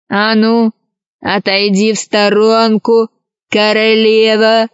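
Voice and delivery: drawn-out pirate drawl; storybook villain's slow warning; threatening pirate. Acted. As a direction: order someone aside as a menacing pirate. Voices the pirate Krys.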